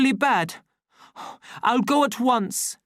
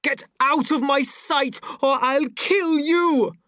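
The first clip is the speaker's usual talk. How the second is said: murderous threat; 13 semitones higher, 5 dB louder than usual. A narrator's reading. Savage threatening Lenina.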